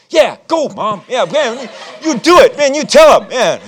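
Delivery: loud, high whoops for Mom; cheering. Retold or imitated. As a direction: shout her on from the sidelines, laughing.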